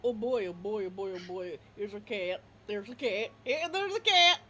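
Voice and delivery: yappy dog voice, building to a loud bark at the cat; overexcited dog barking at a cat; excited dog. Acted.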